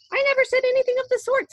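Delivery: very high, loud and fast protest; indignant Wonka. A narrator's reading. Wonka denying Veruca's claim, high and rapid. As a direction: fire it back in a squeaky rush. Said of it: "said Mister Wonka".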